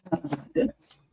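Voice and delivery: breathless whisper under her breath. Real, not acted. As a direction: breathe it out in amazement. Reacting to the price.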